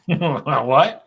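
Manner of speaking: loud high laughing "What?". Real, not acted. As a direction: burst out laughing in disbelief. Co-host hears shocking fight report.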